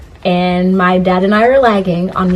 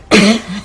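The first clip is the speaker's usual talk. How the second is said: chesty cough. Real, not acted. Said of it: "Sorry, I have a cough".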